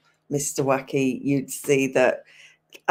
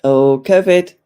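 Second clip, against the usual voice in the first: covid said loud and low, a sudden groan of realisation; loud exclamation; 3 semitones lower, 10 dB louder than usual. Real, not acted. Reading a chat comment, she blurts it out loud and low.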